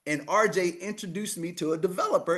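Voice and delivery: normal talking voice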